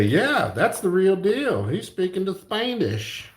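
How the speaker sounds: high-pitched hammed-up character voice; imagined approving listener. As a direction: pitch it high, mock-impressed. Voicing an imagined Spanish speaker's approval.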